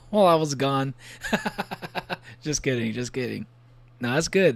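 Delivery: ordinary talk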